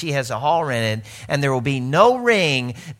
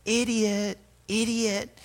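higher, scolding inner voice; 7 semitones higher, 4 dB quieter, slower than usual. Retold or imitated. His inner voice scolding him, higher and softer. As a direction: berate yourself quietly. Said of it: "my discernment kicks off and goes".

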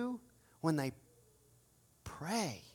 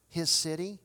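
usual speaking voice

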